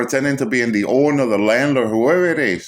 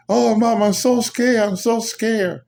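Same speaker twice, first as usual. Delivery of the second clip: put-on scared child; 10 semitones higher, slower than usual. Young son on phone, terrified.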